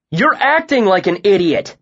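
loud, high angry shout; a full shouted retort. A narrator's reading. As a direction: yell it until the throat hurts. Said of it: "I shouted so loudly".

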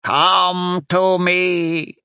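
very slow, high, drawn-out call; witch's summons. A narrator's reading. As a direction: summon from afar, stretching each word. Witch summons something from woods.

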